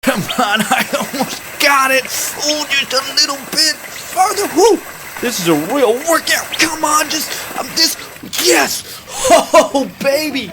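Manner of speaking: high-pitched effort building to a whoop; straining, then triumphant. Acted. Straining to reach, then succeeding.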